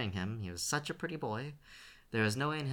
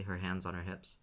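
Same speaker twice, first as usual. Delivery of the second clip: ordinary delivery, nothing marked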